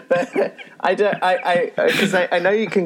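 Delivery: high, giggling through the words; real laughter breaking up the line; laughing. Real, not acted. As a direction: talk through a fit of laughter. Laughs while explaining.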